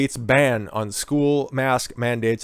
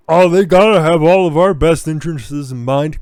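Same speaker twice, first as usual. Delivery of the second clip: mocking voice; 3 semitones higher, 7 dB louder than usual. Mocking authority-trusting people.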